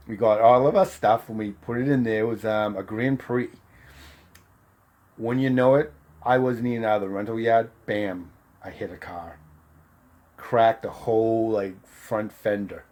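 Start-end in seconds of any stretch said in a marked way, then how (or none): none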